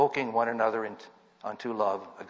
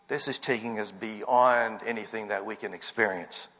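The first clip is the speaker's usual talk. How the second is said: ordinary talk, nothing marked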